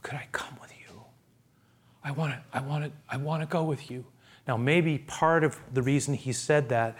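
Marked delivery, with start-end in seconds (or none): 0.0-2.9 soft, hushed begging, almost a whisper; a frightened man whispering a plea; whispered pleading. Acted. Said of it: "I imagine that moment". 3.1-4.0 much softer, hushed "I wanna go with you"; a timid, hushed request to Jesus; quiet plea. Acted. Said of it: "I imagine that moment".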